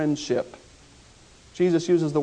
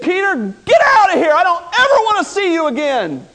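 high, loud furious shout; a betrayed master yelling at his disciple; angry rejection; 10 semitones higher, 8 dB louder than usual. Pretend. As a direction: throw him out with full fury. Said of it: "Jesus could have said".

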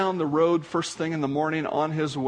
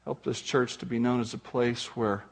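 closing prayer; 5 semitones lower than usual. Acted.

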